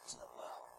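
sudden whisper; hushed trailing whisper. Acted. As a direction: drop to a breath at the end. Preacher dropping to a whisper.

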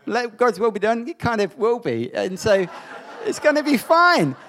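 loud, high, mock-triumphant; ironic excitement. Real, not acted. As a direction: sell the safe prayer with irony. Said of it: "that prayer can't go unanswered".

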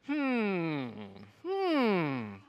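soft curious hmm; interested hmm. Shown as a demonstration. Preacher demonstrating the congregation's interested sound, soft curious hum. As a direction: hum like you're intrigued. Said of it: "your interested sound is".

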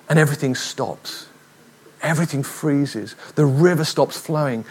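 ordinary talk, nothing marked

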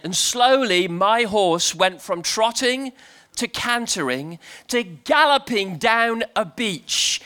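normal talking voice

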